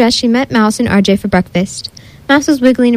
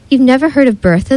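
ordinary delivery, nothing marked

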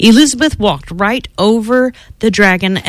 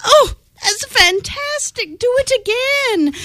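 much higher, gushing cheer; mock excited shout; 4 dB quieter than usual. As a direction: egg him on with wide-eyed delight. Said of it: "Elizabeth shouted".

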